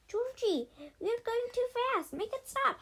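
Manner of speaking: begging him to stop the canoe; panicked plea. A narrator's reading. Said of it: "Sage cried".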